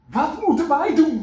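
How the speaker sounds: high, loud questioning plea; urgent plea. Acted. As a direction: beg for an answer. Tax collectors asking John.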